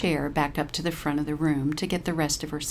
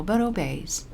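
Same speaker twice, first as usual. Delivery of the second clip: plain reading voice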